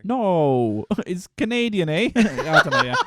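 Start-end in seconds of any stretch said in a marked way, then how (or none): 1.1-2.9 laughing through the words; giggling Canadian joke. Real, not acted. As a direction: crack up mid-line.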